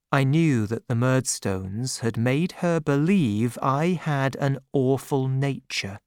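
ordinary delivery

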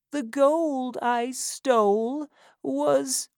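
slow, high, trailing off; breath running out mid-sentence; dying last words. A narrator's reading. Old Sally dying mid-sentence to Missus Corney, slow and fading.

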